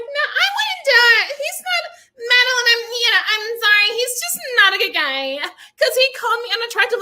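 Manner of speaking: high, loud hammed-up sob voice; mock crying. Mocking Alexis telling Lauren: hammed-up tearful whine. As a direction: fake tearful complaint, overplayed. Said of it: "she's just like".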